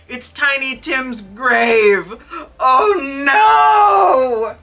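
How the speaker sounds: slow, then a loud high wailing cry; rising into a sobbing shout; anguished cry. A narrator's reading. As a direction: Scrooge wailing at the grave. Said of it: "moans Scrooge".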